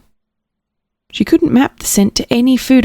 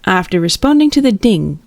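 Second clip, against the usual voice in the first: plain reading voice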